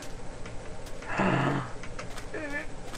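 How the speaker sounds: sharp excited gasp. Real, not acted. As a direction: gasp in delight. Sees trees after searching.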